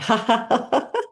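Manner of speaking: high, bright forced laugh; laughing on cue. Shown as a demonstration. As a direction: burst out laughing on purpose. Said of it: "imagine we're putting on our seatbelts while laughing".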